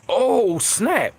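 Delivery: high, loud "oh snap" of amazement; impressed surprise. Retold or imitated. Fan hearing Common for the first time, loud and surprised. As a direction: blurt it out, floored by the rhyme. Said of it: "I'm like".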